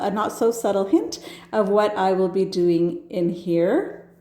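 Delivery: ordinary talk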